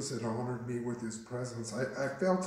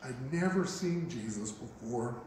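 usual speaking voice

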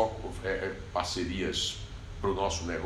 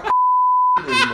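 shout; 12 dB louder, much faster than usual. Real, not acted. Guest says he shouts a lot.

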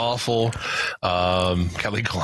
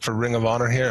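normal talking voice

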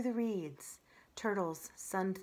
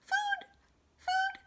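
very high squeaky chick cries; tiny hatchling begging for food; hungry gosling peeps; 4 dB louder than usual. Acted. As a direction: peep for food like a newborn.

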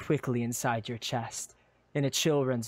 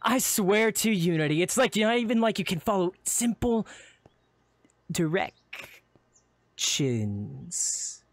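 higher rant slowing to a crawl; exasperated scolding; 5 semitones higher, slower than usual. Acted. Friend berating you about directions, exasperated. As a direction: stretch the last word out in frustration.

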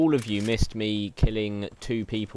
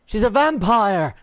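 mock panic; 9 semitones higher, 6 dB louder than usual. Hamming panic in game.

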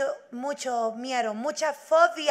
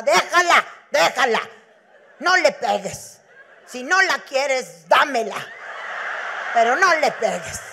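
loud pleading shout, much louder; grandmother shouting; 5 dB louder than usual. Retold or imitated. Grandmother shouts at her daughter not to hit the girl. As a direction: yell at her to stop hitting the child.

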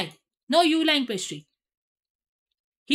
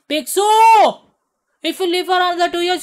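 loud; 3 semitones higher, 8 dB louder than usual. Real, not acted. Calling out to Pixel.

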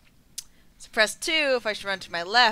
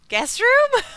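laughing shriek; 11 dB louder than usual. Real, not acted.

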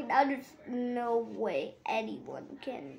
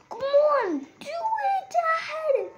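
shrill pleading cry much higher; high desperate yell; 5 dB louder, much slower than usual. Real, not acted. Player frantic in the dark, high yelling plea. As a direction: beg the game at the top of your voice.

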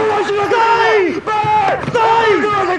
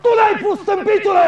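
high, raised-voice shout; angry accusation; 6 semitones higher than usual. Acted. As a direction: hurl the blame at him furiously. Angry quarrel with man over mine.

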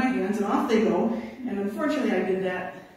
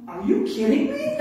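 voicing the stuck dogs, higher through a laugh; dogs' incredulous look; 5 semitones higher, 3 dB louder than usual. Dogs stuck in slush staring back at musher, laughing disbelief. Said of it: "they all look back at me like".

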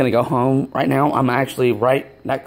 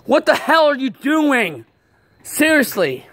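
high-pitched exasperated protest; upset, voice raised in pitch; indignant scolding; 10 semitones higher than usual. Real, not acted. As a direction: tell off an intruder, still rattled. Scolds friend for intruding on vlog.

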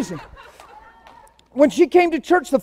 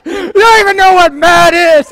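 louder, laughing tease; laughing retort; 4 semitones higher, 8 dB louder than usual. Retold or imitated. [name] laughingly teasing Tammy, loud and amused. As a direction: tease her through a laugh. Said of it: "I'm like".

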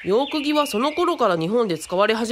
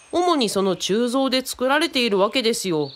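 ordinary talk

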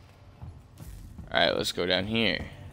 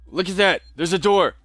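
excited; 10 semitones higher, 6 dB louder than usual. Real, not acted. Excited discovery of a door.

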